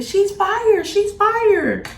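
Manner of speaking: high-pitched gloating singsong; imitated gleeful scream. Shabana gloating that she got fired, high gleeful crowing. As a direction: crow it high like a delighted rival. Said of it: "all you hear is her screaming".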